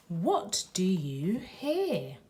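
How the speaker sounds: usual speaking voice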